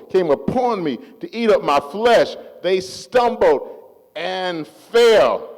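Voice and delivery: high, loud declamation with long-drawn words; forceful scripture preaching. Acted. Preacher recites a psalm of fearless trust to the congregation, forceful preaching. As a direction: proclaim each phrase, stretching it out to a ringing finish.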